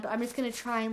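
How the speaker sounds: ordinary delivery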